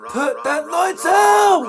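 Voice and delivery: high, slow, commanding call; mock order. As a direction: bellow it like a mock warden. Barked order in the game.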